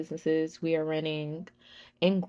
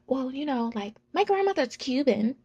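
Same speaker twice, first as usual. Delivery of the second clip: imitating the manager; 6 semitones higher than usual. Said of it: "she was like".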